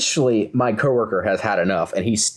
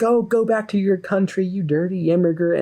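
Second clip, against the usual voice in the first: mock angry yell; 10 semitones higher than usual. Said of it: "is just screaming at him".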